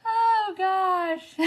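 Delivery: high "oh, gosh" breaking into laughter; helpless with real laughter, pitch up; laughing to tears. Real, not acted. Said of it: "I'm just laughing so hard".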